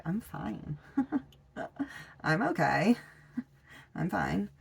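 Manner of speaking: light singsong, pitch swooping up, chuckling between phrases; masking with forced okayness. Acted. Queen of Wands pretending things are right, masking. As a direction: put on a bright mask, giggle it away. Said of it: "she also puts on a mask".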